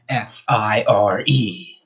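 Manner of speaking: each letter slow and separate; the button label spelled out slowly. Acted.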